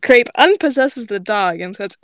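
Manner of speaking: plain reading voice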